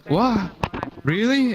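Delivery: squeaky high imitated disbelief; mock surprise. Dante learning his friend knew all along, mock surprise. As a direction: play his shock at the news, way up high. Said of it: "And he goes".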